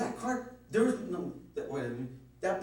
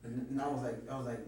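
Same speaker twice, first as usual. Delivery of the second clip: ordinary talk, nothing marked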